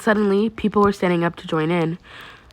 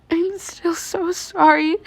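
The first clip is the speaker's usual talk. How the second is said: high, slow, choked apology; 11 semitones higher, much slower than usual. Acted. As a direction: squeeze it out through tears. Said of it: "she choked out".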